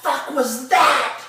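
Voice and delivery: very high, loud, drawn-out yell; retold angry outburst. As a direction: bellow it slowly as the furious boss. Same retold outburst, replayed.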